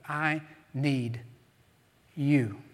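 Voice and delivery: "need" and "you" split by a long pause; word-by-word emphasis. Shown as a demonstration. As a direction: set "you" apart as its own falling beat. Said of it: "you emphasize each different word".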